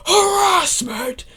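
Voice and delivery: sudden high, loud yell; shouted outburst. Acted. Grandmother replying to OP about harassment, shouted outburst. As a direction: burst out loudly on "harassment".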